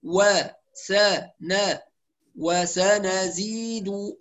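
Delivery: very slow "وسنزيد", broken into measured syllables; full vowels, unrushed. Shown as a demonstration. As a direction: give each short vowel of "وسنزيد" its full time. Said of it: "لا نختلس". ("وسنزيد" ببطء مع إتمام الحركات)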